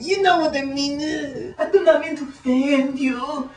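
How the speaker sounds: quoting a comedian mid-rant; imitated voice. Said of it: "and he said".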